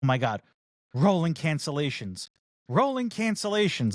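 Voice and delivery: usual speaking voice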